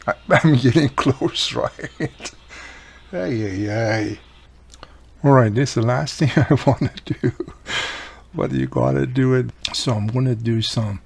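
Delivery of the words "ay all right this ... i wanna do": ordinary delivery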